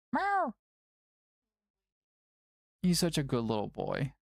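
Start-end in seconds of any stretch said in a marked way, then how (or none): 0.1-0.5 high, squeaky meow; cat meow. Pretend. Streamer meows at his cat Milo, high squeaky meow. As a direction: meow back at the cat like a kitten.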